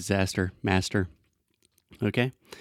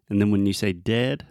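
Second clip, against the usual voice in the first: usual speaking voice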